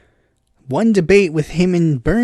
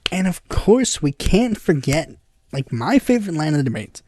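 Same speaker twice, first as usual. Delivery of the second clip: ordinary delivery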